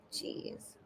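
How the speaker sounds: breathy whispered sigh of a word; whispered jeez. Real, not acted. Vlogger reacting to news to herself, whispered sigh. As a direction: let it out on a tired breath. Sighs at fan-sign news.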